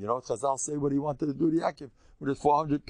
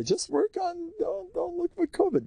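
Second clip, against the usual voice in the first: much higher voice, sing-song quote; high mock quote; quoting a rabbi. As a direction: lightly voice the wise man's advice. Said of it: "He would say".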